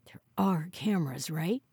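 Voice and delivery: worried whisper ending on a question. A narrator's reading. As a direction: keep whispering, seeking reassurance. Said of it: "he whispered".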